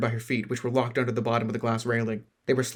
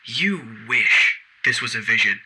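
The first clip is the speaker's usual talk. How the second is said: menacing villain; 6 dB louder, slower than usual. Acted. Villain taunts captive.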